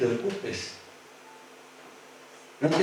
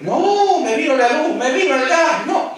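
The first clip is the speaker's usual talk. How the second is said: much higher, louder mock wail over bills; mock panicked complaint; 13 semitones higher, 6 dB louder than usual. Believer panics over light and gas bills, mock wailing. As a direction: whine like the bills just landed.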